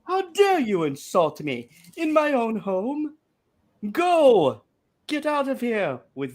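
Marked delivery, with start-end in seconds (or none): 0.0-0.3 put-on hammy slow Old English accent; mock Old English banishment. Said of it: "the worst Old English accent".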